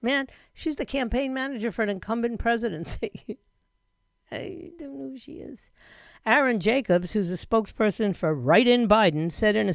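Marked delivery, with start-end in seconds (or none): 3.4-5.7 quiet words through a real laugh. Real, not acted. Host admitting the manager is unknown to him, laughing softly. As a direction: trail into soft, amused laughter.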